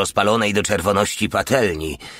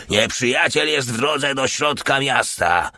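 repeated warning, higher; 6 semitones higher than usual. Acted. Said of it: "powtórzył".

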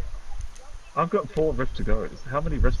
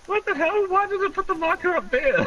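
very high-pitched bafflement; incredulous squeal. Real, not acted. Player baffled by a misplaced marker, incredulous squeal. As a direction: squeak it out in disbelief.

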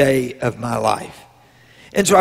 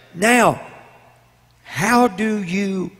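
usual speaking voice

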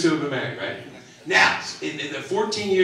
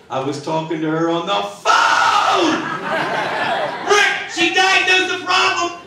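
mock argument shout; 13 semitones higher, slower than usual. Comic reenacting a shouting match with his wife, mock yelling. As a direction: yell it like a husband losing the argument. Quarrel over sister's diagnosis.

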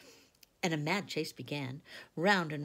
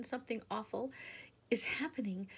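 frightened; 6 semitones higher than usual. Acted. Susan frightened at dawn.

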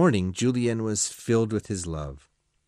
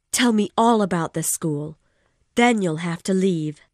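agitated woman, raised and loud; Missus de Renal, loud and pitched high; 9 semitones higher, 5 dB louder than usual. Acted. Woman reproaching her lover, loud and upset. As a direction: pour it out, upset. Woman character voiced in story.

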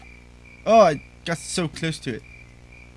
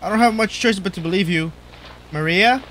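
ordinary delivery

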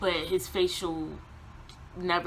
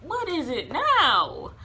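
exasperated retort; 8 semitones higher, 4 dB louder, much slower than usual. Retold or imitated. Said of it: "And I'm like".

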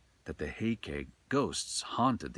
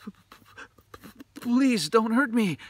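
frightened plea; 9 dB louder than usual. A narrator's reading. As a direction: beg in terror, tripping over the first word. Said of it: "he cried".

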